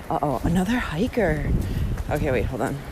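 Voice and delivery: hushed. Real, not acted. Hiker approaching.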